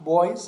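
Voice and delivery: put-on "boys" with the last s said as z; final S as Z. Shown as a demonstration. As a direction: say "boys" slowly with a z ending.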